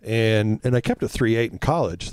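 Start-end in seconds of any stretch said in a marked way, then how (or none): none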